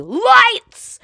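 high, loud called-out cue; yelling. Retold or imitated. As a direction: shout the cue from backstage. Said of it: "the technician yelling behind you".

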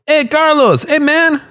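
high, loud eager call across the wall. Acted. Young watchman hailing Carlos, high and eager. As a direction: shout a cheerful greeting from afar.

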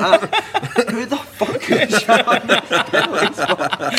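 high, slow, incredulous retold yell; mock outrage. Groundsman finding the mess on the spot, comically outraged. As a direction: imitate an appalled groundsman, drawing it out.

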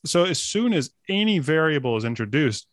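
normal talking voice

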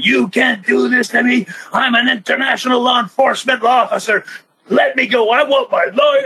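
high, pleading mock outrage; hammed-up protest while dragged off. Wrestler replays his jail-cell protest, hammed-up high pleading.

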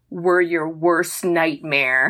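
low, slow threat; hammed-up tough kid; mock menacing elf. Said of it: "that elf kid goes".

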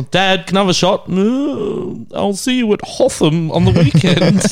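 mock private school kid. Host mocks a private school family, hammed-up posh kid act. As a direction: put on a spoilt schoolkid act. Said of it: "he's a private school kid".

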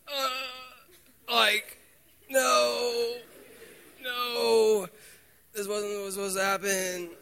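high, loud disbelieving no; reliving the shock of rejection; shocked disbelief. Retold or imitated. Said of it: "and I was like".

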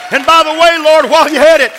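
high, loud, shouted preacher's plea; full-force preaching prayer. Real, not acted. Preacher praying for a healing station, full-force shouted preaching.

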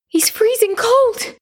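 high, drawn-out cry of alarm; distraught cry. A narrator's reading. Mother grabs her freezing son's face, distraught high cry. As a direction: cry out slowly, pitch far up.

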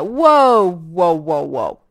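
dawning realisation, trailing off; mock amazement at herself; stunned whoa. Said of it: "And I was like".

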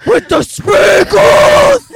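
shout. Pretend. Blooper shouting food names.

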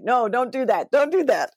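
a hint of a Southern accent, rising high; accent slipping out. Host joking about her urge to slip into the accent, mock Southern. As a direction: lightly voice the accent, ending up high. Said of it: "put a filter on my mouth to say".

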